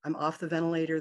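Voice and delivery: ordinary delivery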